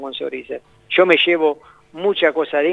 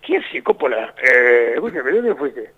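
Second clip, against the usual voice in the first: Diego voice; 4 semitones lower than usual. Retold or imitated. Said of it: "le hago la voz de Diego".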